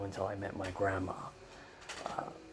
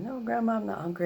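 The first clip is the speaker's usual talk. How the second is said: put-on young self's reply; 12 semitones higher, 4 dB louder than usual. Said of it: "I said".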